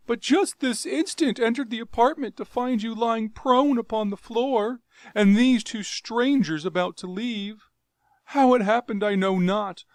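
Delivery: high, loud fawning plea; frightened pleading. Acted. Jav pleading innocence to his Jeddak, frightened. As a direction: beg him to believe you.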